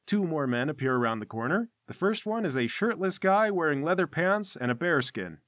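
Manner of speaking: ordinary talk, nothing marked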